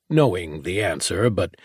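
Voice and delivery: ordinary delivery, nothing marked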